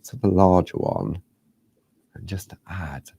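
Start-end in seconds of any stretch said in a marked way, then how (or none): 2.2-3.1 unvoiced whisper. Real, not acted. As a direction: whisper while adding detail. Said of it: "I don't know why I'm whispering".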